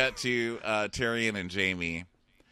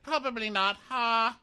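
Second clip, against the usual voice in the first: high teasing voice; mock snarky Arya. Arya needling the Hound about helping. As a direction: toss it off sarcastically.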